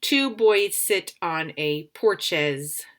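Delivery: slow read-aloud testing the wrong word; slow trial reading. Shown as a demonstration. Teacher slowly tests the wrong word aloud. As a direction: try porches in the blank, slowly. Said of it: "try it again with the other word".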